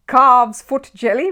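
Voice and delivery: stunned, as if in a dream; dazed repeat. A narrator's reading. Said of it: "as if in a dream".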